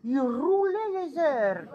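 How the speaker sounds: slow, rolled-r southern imitation; mock rolled-r southern accent; rolled r's. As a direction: roll every r in "airs" slowly and heavily. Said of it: "Il parlait comme ça".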